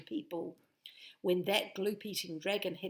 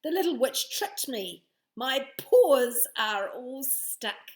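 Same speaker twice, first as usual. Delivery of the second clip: louder, higher whine; complaining dragon cry; 5 semitones higher, 11 dB louder than usual. Acted. Stuck dragon complaining about the witch, loud. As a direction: cry out in sticky dismay.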